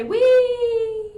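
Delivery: put-on high, loud childish Wee; mock gleeful squeal. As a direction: squeal like a kid on a ride. Pilot boyfriend flying.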